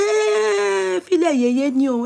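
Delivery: long high cry, then rushed words; mock a mother's exasperated call; imitated dismissal. Mother telling others to leave the crier, imitated dismissal.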